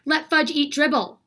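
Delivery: loud high mock scream. A narrator's reading. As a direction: cry it out in outrage. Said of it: "I screamed".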